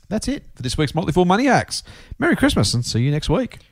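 ordinary talk, nothing marked

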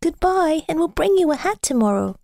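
put-on children calling out. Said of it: "they called to him".

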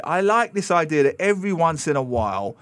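ordinary talk

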